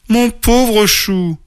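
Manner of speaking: high, slow, drawn-out exclamation; dismayed exclamation. A narrator's reading. Mother bear finding her cub covered in mud, exclaiming. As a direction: fuss over a child in dismay. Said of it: "s'exclama maman ours".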